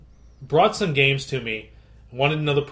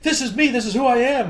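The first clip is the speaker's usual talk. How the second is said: a high, whiny put-on voice; mimicking the stubborn customer, higher; mock defiant customer; 9 semitones higher than usual. Customer's defiant reply, retold.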